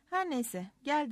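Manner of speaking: plain reading voice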